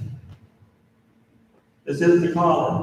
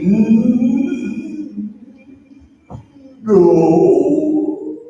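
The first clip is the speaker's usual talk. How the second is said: high, loud, drawn-out hammed-up no; reluctant refusal; 10 semitones higher, 5 dB louder than usual. Priest about to refuse his spare collar, exaggerated reluctant no.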